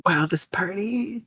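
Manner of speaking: breathy whisper, barely voiced. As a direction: whisper it as a sarcastic aside. Reading a party scene aloud.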